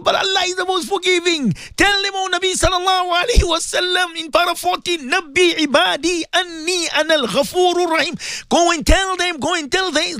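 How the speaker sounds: full-force pulpit appeal; fiery preaching. Real, not acted. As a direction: preach it hard to the whole ummah.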